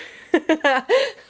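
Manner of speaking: high, loud amused laugh; bright laugh. Real, not acted. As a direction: laugh at the odd verse. Joking about obscure verse.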